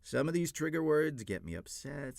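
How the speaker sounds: normal talking voice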